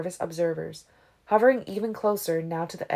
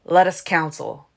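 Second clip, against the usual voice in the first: forceful, eager proposal, louder; 6 dB louder than usual. A narrator's reading. Caius presses the ancients to confer, eagerly. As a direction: urge it with keen force. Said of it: "Caius said eagerly".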